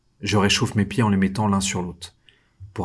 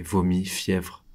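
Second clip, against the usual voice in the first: plain reading voice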